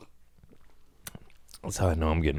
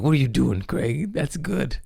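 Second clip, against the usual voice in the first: lifting higher into a silly affirmation voice; mock self-scolding; 6 semitones higher than usual. Scolding himself then praising, rising high and silly. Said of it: "I'm like".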